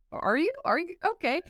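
unconvinced listener humouring them; sceptical. Retold or imitated. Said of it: "you're going".

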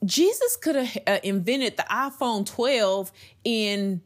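high, excited questions, phone held up; excited retelling. Retold or imitated. Showing the iPhone to her family, high and excited. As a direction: marvel aloud at what Jesus could have made. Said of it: "I held it up and I said".

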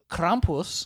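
drawled Americanized "Krampus" with a broad a; smug yuppie drawl. Shown as a demonstration. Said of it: "I'm gonna say this like a yuppie".

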